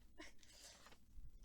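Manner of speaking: soft laugh. Real, not acted. Laughs at Jason line.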